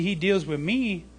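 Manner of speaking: usual speaking voice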